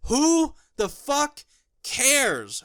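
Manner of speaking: slow, high, scornful who the fuck; slow sneering dismissal; scornful outburst. Real, not acted. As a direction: drag it out in contempt. Ranting at the movie.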